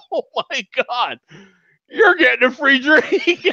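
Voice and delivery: high, laughing delighted exclaim; excited disbelief. Retold or imitated. Attendant decides to treat the newly divorced passenger, high and excited. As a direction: gush with sudden generosity. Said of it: "And she was like".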